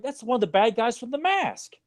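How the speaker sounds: retelling his stunned reaction in a high voice; a hammed-up what-am-I-seeing voice; incredulous disbelief. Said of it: "It's like".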